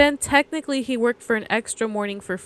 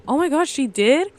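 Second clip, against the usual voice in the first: higher, mildly imitated surprise; retelling a kid's startled question; shocked kid; 6 semitones higher than usual. Teacher voices a student fooled by her fib, shocked.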